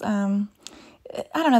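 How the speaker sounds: ordinary talk, nothing marked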